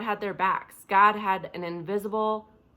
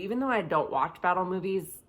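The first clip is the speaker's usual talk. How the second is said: ordinary talk, nothing marked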